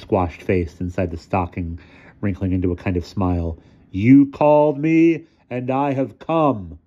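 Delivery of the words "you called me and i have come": slow, raised, portentous intoning; ominous apparition. A narrator's reading. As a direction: intone like a summoned spectre. Disguised apparition addresses the children.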